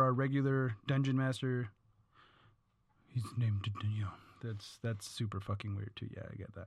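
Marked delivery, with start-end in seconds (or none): 3.1-6.7 hushed aside; whisper. Real, not acted. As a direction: drop to a secretive whisper.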